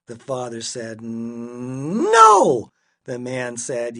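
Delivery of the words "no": "No" on a long-held n, bursting out loud; "No" swelling from held hum to shout; stubborn refusal. A narrator's reading. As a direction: hum the start of "No", then explode. Stubborn argument escalates.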